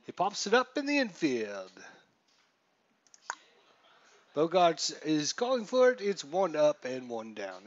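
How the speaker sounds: a hammed-up, loud, high Boston announcer voice; bad Boston accent. Host welcoming viewers to Fenway in a mock Boston accent. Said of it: "That's a pretty terrible Boston accent".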